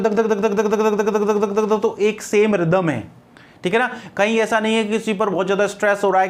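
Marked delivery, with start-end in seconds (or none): 0.0-1.8 even rapid dhak dhak, same beat each time; machine gun imitation. Shown as a demonstration. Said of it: "तो उसमें क्या होता है". (Machine gun जैसी एक समान धक धक आवाज़)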